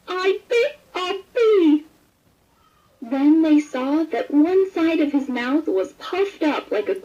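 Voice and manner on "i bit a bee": high, slow, odd pained voice; stung frog. A narrator's reading. Frog with a stung mouth complains to everyone, high and slow. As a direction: whine it through a swollen mouth.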